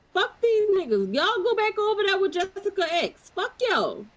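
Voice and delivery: high-pitched real exasperation; heated dismissal. Real, not acted. Heated rant dismissing rival group.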